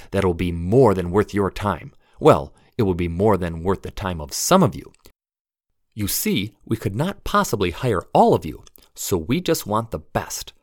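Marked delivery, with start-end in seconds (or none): none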